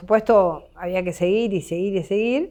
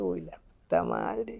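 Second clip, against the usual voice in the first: slow, hushed curse under the breath; muttered dismay; 9 dB quieter, slower than usual. Retold or imitated. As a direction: quietly swear in disbelief. Dismay on hearing of the tweet.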